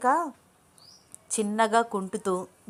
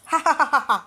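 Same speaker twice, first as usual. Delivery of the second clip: loud, higher villain's cackle; menacing laugh; 6 semitones higher, 5 dB louder, much slower than usual. A narrator's reading. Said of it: "అని భయంకరంగా నవ్వుతూ".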